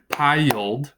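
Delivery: piled said as PIE-uld; piled broken after the long i, pie-uld; piled in two syllables. Shown as a demonstration. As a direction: stretch piled into two beats, pie-yuld. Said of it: "That would give us two syllables".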